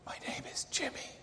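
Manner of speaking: put-on quick, high child's whisper. A narrator's reading. Little Jimmy whispering his name to the caller. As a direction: whisper it fast like a small boy. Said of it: "The little voice said".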